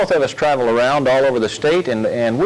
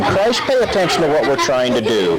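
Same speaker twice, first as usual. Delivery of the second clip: normal talking voice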